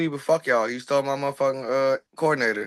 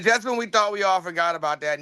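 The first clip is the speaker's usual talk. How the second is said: usual speaking voice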